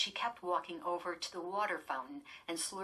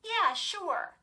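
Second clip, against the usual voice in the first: sarcastic; 13 semitones higher, 7 dB louder than usual. Acted. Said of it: "Lindy said sarcastically".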